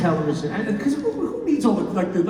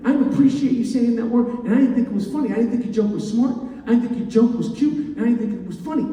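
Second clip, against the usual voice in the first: louder, higher indignant rant; angry fan tirade; 5 semitones higher, 4 dB louder than usual. Retold or imitated. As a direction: chew him out like an offended longtime fan. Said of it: "he goes".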